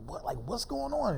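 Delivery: high-pitched, bewildered question; retold bewilderment. Retells being held at gunpoint.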